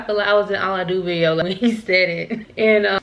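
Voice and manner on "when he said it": ordinary talk, nothing marked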